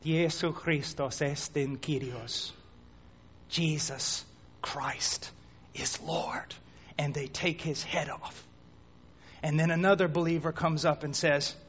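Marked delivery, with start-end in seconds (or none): 0.0-6.6 soft, slow, fading into a whisper; trembling believer. Acted. Believer facing death, trembling soft confession. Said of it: "with tears running down his cheeks". 7.0-8.4 hushed. A narrator's reading. Hushed narration of execution.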